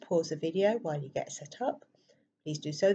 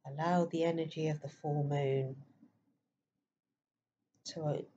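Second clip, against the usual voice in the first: guided meditation; 6 dB quieter than usual. Acted. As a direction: softly guide listeners into stillness, leaving space between phrases.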